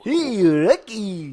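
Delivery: high cartoon moose call; Bullwinkle voice. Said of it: "like, the Rocky and Bullwinkle?".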